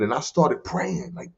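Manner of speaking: normal talking voice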